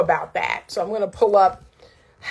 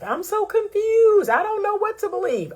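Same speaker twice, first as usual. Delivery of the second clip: confused woman; 12 semitones higher than usual. Retold or imitated. Coach mimicking clients who come to her, high confused voice. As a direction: voice a bewildered client, pitched way up. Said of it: "the number one word that women use".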